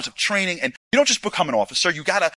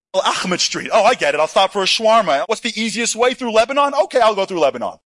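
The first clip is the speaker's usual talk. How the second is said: mock; 4 semitones higher, 5 dB louder than usual. Acting out a casual map user.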